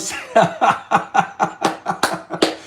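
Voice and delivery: long, breathy run of laughter; real laugh. Real, not acted. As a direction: crack up for real. Laughs at viewer's comment.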